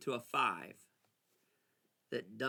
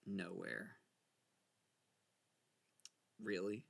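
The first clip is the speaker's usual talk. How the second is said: ordinary talk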